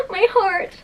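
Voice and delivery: high, crying gush. Real, not acted. Moved by an illustration, a high gushing cry. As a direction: clutch at your heart in delight.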